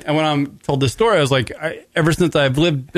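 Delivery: ordinary talk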